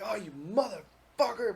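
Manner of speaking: high, loud, drawn-out curse; mock gamer rage. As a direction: curse your killer in exaggerated, stretched-out outrage. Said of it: "or I get mad".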